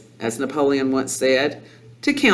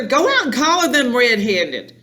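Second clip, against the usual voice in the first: high-pitched, insistent command; pushy, alarmed wife; urgent hissing; 8 semitones higher than usual. A narrator's reading. Mother urging reluctant father onward, urgent hissing. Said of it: "hissed the mother".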